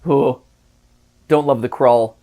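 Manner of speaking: ordinary delivery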